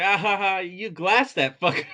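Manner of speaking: imitating a gloating kid; gleeful mock laugh. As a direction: crow it with a fake ha-ha laugh. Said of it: "He's like".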